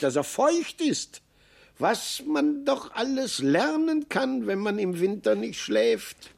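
high, squeaky little marmot marvelling; a little marmot musing brightly, voice pitched high. Acted. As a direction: pitch up into a small wondering animal voice. Marmot Maxel voiced in story.

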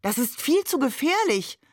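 high-pitched protesting call; mildly exclaimed objection. A narrator's reading. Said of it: "rief Maman auch schon".

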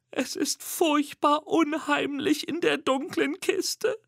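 high, slow, fearful squeak; whispering little monster. A narrator's reading. Little monster afraid in the dark crate, high hushed squeak. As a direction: confide fear in a tiny piping voice. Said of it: "flüstert Mimi".

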